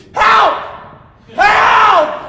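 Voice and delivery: high, loud panicked yell; desperate shouted cry for help. Retold or imitated. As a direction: scream for help like you're drowning. Panicking caught in rip current.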